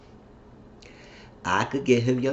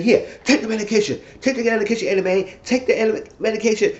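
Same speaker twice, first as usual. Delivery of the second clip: higher and louder, pushy pleading; mock insistent husband; 12 semitones higher, 4 dB louder than usual. Bennett presses his wife to take her medication, higher and louder. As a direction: badger her to take it in a raised pitch.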